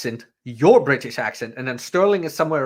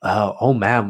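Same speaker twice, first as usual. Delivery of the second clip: ordinary delivery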